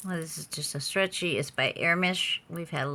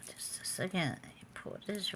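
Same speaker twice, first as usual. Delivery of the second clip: whisper; 2 semitones higher, 11 dB quieter than usual. Real, not acted. Whispering while adjusting item.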